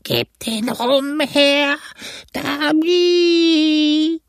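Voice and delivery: very high, slow, stretched-out wailing demand; drawn-out spectral shriek for the rum. A narrator's reading. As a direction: howl it as a cursing phantom. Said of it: "etwas lauter und höher und mit einem Fluch".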